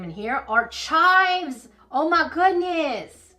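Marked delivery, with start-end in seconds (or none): 1.9-3.3 high, loud, drawn-out gushing; excited delight. Real, not acted. Reacting to tiny chives in the package, excited delight. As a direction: gush slowly and high with delight.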